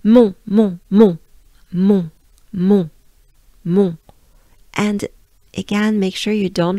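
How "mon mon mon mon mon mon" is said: mon repeated loudly with strong nasal vowel; nasal French mon. Shown as a demonstration. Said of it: "it's very nasal, very French".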